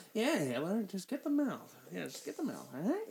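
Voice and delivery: soft, mumbled celebrity impression; Adam Sandler. Comedian coaxes Hitler in the threesome skit, soft impression. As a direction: trail off quietly, coaxing in a put-on voice. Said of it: "Adam Sandler's like".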